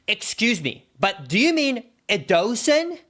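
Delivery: imitated condescending correction, pitch lifted; patronizing "excuse me", retold with attitude; mocking correcting tone. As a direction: act out a snide correction as a pointed question. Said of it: "the way she said it with that".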